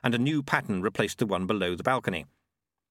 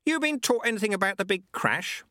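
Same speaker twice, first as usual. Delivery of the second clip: raised voice; 12 semitones higher than usual. Acted. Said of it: "Lutze raised his voice again".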